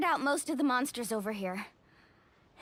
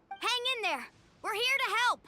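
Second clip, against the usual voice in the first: high-pitched, encouraging call-out; rallying call; 10 semitones higher than usual. Acted. Arriving to help struggling fighters, high rallying call. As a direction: call to allies under attack.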